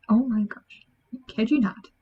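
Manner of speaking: ordinary delivery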